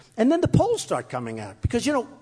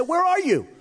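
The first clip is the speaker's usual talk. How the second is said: mock-exasperated call to Mitt; 9 semitones higher than usual. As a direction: call out where he's hiding. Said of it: "I said".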